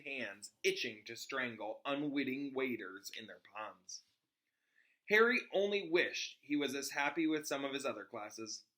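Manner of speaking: steady read-aloud storytelling voice; story narration. Acted. Reader narrating a book chapter to her audience, storytelling. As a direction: read the chapter to listeners, even and clear. Reads Harry Potter aloud.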